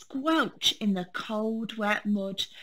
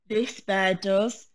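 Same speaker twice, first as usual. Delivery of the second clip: knowing rabbit; 5 dB louder than usual. Acted. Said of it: "said the rabbit knowingly".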